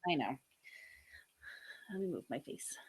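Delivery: whispered aside. Real, not acted. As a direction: say it under your breath.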